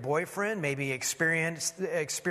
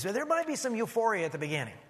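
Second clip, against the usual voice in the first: usual speaking voice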